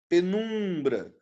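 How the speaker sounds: Penumbra, slow, with NUM held; stress on middle syllable. Shown as a demonstration. Teacher repeating for students, stretched tonic syllable in Penumbra. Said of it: "Então, o num é o quê?".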